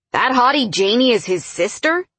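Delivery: high-pitched, astonished question; incredulous. Acted. Man learns the intern is his rival's sister, incredulous. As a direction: blurt it out in disbelief. Reacts to surprising news about intern.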